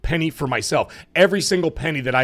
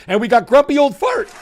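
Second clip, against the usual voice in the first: hyped, high loud shout-out cracking; voice giving out; 11 semitones higher, 6 dB louder than usual. Real, not acted. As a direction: announce it loud on a worn-out voice. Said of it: "my voice is gone".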